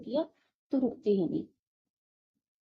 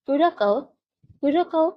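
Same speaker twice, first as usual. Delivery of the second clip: Curaçao, raised and projected to the whole class; loud announcement; 5 semitones higher, 8 dB louder than usual. Shown as a demonstration. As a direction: call out the country name loudly, like a teacher to the class. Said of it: "teacher announcing next country loudly". (ज़ोर से घोषणा करती हुई teacher)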